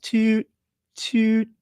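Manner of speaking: high little toot toot; tooting horn. Retold or imitated. Streamer mimicking Amber tooting her own horn, high toots. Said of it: "Like that".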